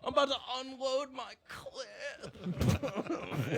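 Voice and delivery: high, very slow mock moan; sex voice. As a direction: stretch it out like a hammy climax. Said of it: "that's your cum voice".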